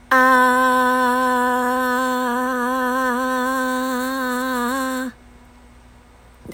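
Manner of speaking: long, loud sustained ah at speaking pitch; chest voice ah. Shown as a demonstration. Coach leads listeners in a comfortable sustained ah. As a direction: hold an easy ah from the belly. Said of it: "一番楽な声で".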